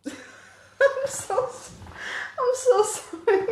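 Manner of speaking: high, giggly sorry, barely voiced at first; embarrassed giggly sorry; giggling apology. Real, not acted. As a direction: laugh helplessly while apologising. Apologising through laughter.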